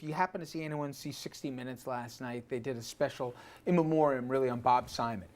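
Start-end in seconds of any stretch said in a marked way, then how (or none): none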